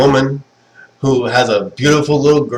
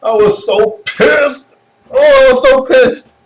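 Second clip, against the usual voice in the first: mock outrage; 12 semitones higher, 4 dB louder, slower than usual. Real, not acted. Recalling anime outrage.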